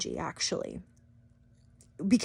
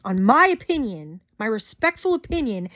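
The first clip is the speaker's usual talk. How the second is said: ordinary talk, nothing marked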